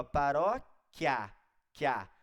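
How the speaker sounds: paróquia slow and loud, then quia on its own; syllables stretched out. Shown as a demonstration. Teacher showing how the word ends, paróquia drawn out, quia repeated. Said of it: "Tá terminando em quê".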